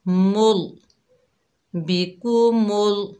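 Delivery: मुल with a thick, heavy lam, said slowly; slow बिकुमुल with a full, dark lam sound. Shown as a demonstration. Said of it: "मोटा पढ़ा जाएगा".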